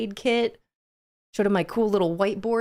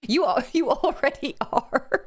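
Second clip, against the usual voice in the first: slow, higher, laughing it out; amused laugh; 7 semitones higher than usual. Real, not acted. Streamer answers Grandma Fish's chat, laughing. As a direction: say it through a giggle.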